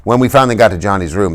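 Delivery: ordinary delivery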